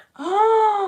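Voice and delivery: loud, high, voiced gasp; big drawn-in "oh" of realisation; excited gasp. Real, not acted. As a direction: gasp at a sudden idea. Delighted at her own idea.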